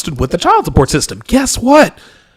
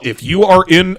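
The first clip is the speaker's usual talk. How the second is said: normal talking voice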